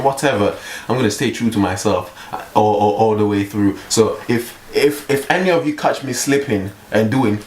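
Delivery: ordinary delivery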